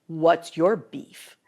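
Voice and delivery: slow, drawn-out tough delivery; slow mock-intimidating challenge; meaner. Shown as a demonstration. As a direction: say it mean, stretching every word. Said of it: "say that a little, like, meaner".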